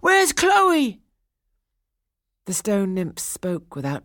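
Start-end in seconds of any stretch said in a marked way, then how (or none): 0.0-1.0 high, loud desperate demand; desperate cry. A narrator's reading. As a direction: demand to know. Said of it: "cried Daphnis".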